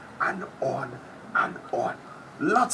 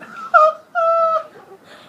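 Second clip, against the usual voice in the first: booming high evil laugh; loud hammed-up laugh; loud villain laugh; 13 dB louder than usual. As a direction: cackle like a gleeful villain. Huge laugh after threat.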